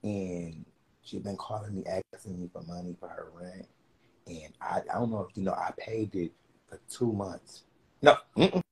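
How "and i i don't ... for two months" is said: a low confidential murmur; hushed confiding. Real, not acted. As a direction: lean in and whisper the secret. Secret gossip on phone.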